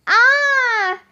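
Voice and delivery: high, drawn-out cry of alarm; frightened yell. A narrator's reading. Boy as a gigantic snake drops before him, frightened yell. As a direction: yell in fright, pitched well up. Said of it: "Jack yelled".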